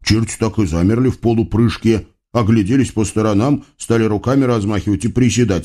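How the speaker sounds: ordinary delivery, nothing marked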